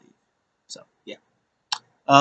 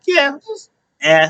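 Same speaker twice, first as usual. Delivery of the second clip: high mock shrug; 12 semitones higher than usual. Finn's shrug reply, retold.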